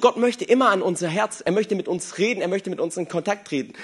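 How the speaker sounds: usual speaking voice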